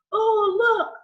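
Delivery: imitating a small boy's loud blurt, high-pitched; loud little boy. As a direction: call it out high and loud, drawn out, like a toddler. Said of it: "he said it so loud".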